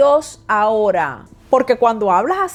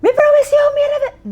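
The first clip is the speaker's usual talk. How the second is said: a much higher, louder shout of the phrase; gritando; 4 dB louder than usual. Shown as a demonstration. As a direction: yell it out to show what not to do. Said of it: "No es que tienes que gritar". (gritado, mucho más agudo y fuerte)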